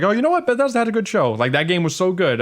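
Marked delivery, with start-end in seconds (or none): 0.0-1.3 impressed. Retold or imitated. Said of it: "I was like".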